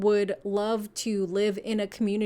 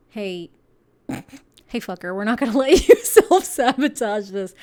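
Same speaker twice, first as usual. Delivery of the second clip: louder, laughing through a cheeky scolding; laughing mock universe; 9 dB louder than usual. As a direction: tease the listener through laughter. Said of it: "the universe is like".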